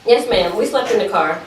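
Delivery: ordinary delivery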